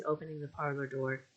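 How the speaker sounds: plain reading voice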